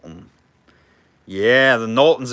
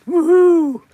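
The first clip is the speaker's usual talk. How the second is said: high, loud woohoo; excited whoop; 8 dB louder than usual. Real, not acted. Auctioneer cheering over nice colored glass, high excited whoop.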